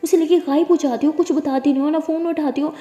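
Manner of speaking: put-on mother angrily blaming the girl, loud; angry scolding. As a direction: let the blame pour out. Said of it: "तारा पे गुस्सा करने लग जाती है".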